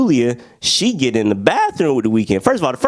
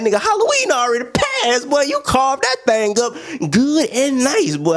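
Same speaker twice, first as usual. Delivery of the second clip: mock disbelief; 13 semitones higher than usual. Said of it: "I'm like".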